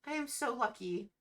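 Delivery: high-pitched grateful castaway; swimmer Lucky, grateful. Acted. Rescued swimmer thanks the hero, high and grateful. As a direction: gush thanks in a high character voice.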